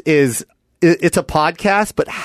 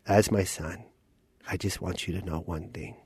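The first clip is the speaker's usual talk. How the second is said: very low, soft dad voice; father; 10 semitones lower, 14 dB quieter than usual. Acted. Dad reviewing his son's movie, low and hushed. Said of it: "I'm gonna play your dad".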